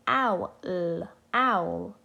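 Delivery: "owl" with a clear ow vowel; ow sound. Shown as a demonstration. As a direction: say "owl" slowly, stretching the ow. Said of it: "O and W together make the ow sound".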